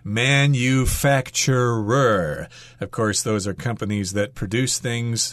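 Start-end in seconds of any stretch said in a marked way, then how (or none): 0.0-2.5 Manufacturer said very slowly, syllable by syllable; over enunciating. Shown as a demonstration. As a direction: stretch out Manufacturer, each sound clear. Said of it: "say it slowly so you can practice it".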